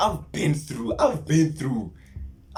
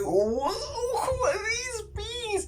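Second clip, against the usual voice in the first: much higher, slow, laughing wonder; amazed; much slower than usual. Retold or imitated. Meeting the beings on his trip, high amazed laugh. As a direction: marvel at them, half laughing.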